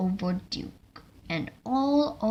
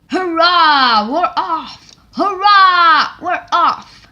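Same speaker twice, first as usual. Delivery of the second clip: high, loud cheering; excited cheer; 9 semitones higher, 16 dB louder, slower than usual. Acted. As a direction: shout it with joy as the train sets off. Cheering departure.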